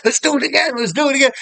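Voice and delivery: put-on high-pitched, eager kid's plea; retold childish excitement. Retold kids eager for another ride.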